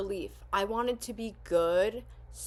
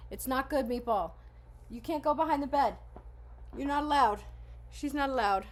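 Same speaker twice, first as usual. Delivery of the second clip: higher, firm pet-scolding voice; scolding the dog; 5 semitones higher than usual. Real, not acted. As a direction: tell the dog off, a bit higher and firmer.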